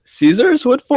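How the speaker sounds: high, surprised mock girl voice. Mimicking the foreign girl asking what the scissors are for, high and surprised. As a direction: squeak it out as a confused young woman. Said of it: "And she goes".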